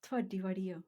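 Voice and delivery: soft, gentle demonstration; softly spoken, careful not to sound like swearing; delicate. Shown as a demonstration. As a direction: say it delicately, well under normal volume. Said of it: "Say it delicately".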